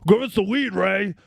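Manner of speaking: put-on high-pitched, pestering drunk demands; imitating a belligerent brother hounding someone; mock drunken berating. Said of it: "fucking berating him and berating him".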